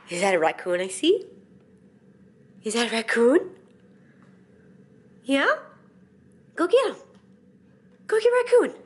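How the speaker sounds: high, excited egging-on of the cat; playful pet talk. Real, not acted. Urging her cat to chase the toy raccoon, excited and high.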